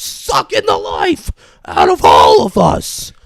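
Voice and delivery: slow, loud, strained mock shout; shouted outburst. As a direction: act out a drawn-out furious yell. Son's retold outburst, smashing things.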